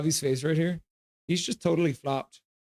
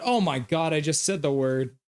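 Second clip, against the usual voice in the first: ordinary talk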